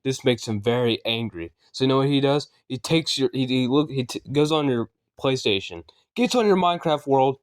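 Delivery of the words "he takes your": plain reading voice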